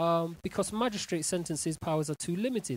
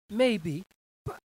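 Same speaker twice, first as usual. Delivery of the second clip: ordinary talk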